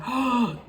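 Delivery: sharp theatrical gasp; mock gasp. Recapping the episode's twist, mock gasp. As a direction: gasp as if shocked by the arrival.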